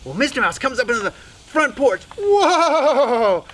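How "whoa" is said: loud, high, wavering cry; wobbling long whoa. Acted. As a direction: cry out as if skidding to a halt. Mouse careers onto porch, stopping.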